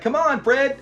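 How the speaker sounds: imitated calling out. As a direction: mimic calling a friend over. Voices friends calling him over.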